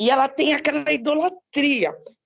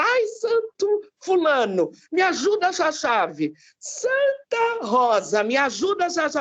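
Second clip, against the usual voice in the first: imitated desperate plea; 3 semitones higher than usual. Daughter mimicking mother who lost her key, desperate pleading. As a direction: wail mildly to the saints, imitating someone desperate. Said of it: "ela se desespera".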